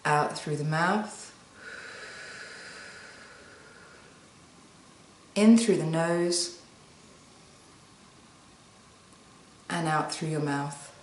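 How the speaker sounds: slow, soft breathing cues; guided breathing. Shown as a demonstration. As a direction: draw out each cue with long pauses. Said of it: "So try this with me now".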